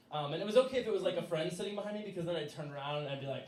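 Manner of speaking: plain reading voice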